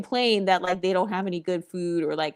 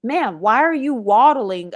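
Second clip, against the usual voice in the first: slow, louder, sarcastic "waddling"; mock retort; 3 semitones higher, 5 dB louder, slower than usual. Vlogger mocking a critic of her walk, slow and sarcastic. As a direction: drag out the jab. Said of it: "And it's like".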